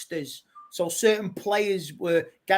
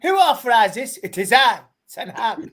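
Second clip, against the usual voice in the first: loud, grand mock Dutch manager; Ten Hag voice; 3 semitones higher, 7 dB louder than usual. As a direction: boom it out as a proud manager. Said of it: "you got a request, [name], to do your Ten Hag impression".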